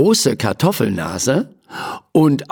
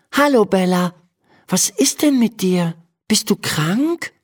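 put-on higher, gentle child voice of Emma; a worried girl asking her sick horse; 9 semitones higher than usual. A narrator's reading. Girl asks her pony if it is sick, worried. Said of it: "fragt Emma".

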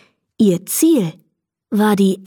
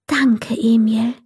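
slow, whispery fairy voice; tender goodnight; 4 semitones higher, slower than usual. A narrator's reading. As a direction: whisper a grateful goodnight, drawing it out.

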